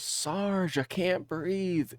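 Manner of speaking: high, soft, slow whimper; mock gasping plea; whiny plea. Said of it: "She goes".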